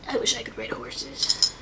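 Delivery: low unvoiced whisper to herself; whispered aside. Real, not acted. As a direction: breathe it wistfully as an aside.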